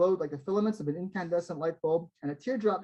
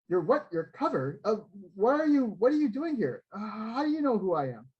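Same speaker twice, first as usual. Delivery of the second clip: put-on higher, flustered questions from a startled young fan; higher, flustered young Joel; 5 semitones higher than usual. A narrator's reading. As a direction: raise the pitch and let the questions tumble out in confusion. Joel, shocked, questions his idol.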